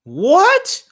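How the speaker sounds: high, loud, stretched-out disbelief; raging gamer. Real, not acted. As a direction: scream at the screen in outrage. Gamer loses the match.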